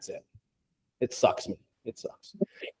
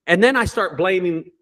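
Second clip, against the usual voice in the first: ordinary delivery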